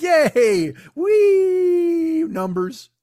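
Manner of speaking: high, shouted mock glee; giddy excited squeal. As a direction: squeal like a thrilled simpleton. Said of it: "stupid people are just like".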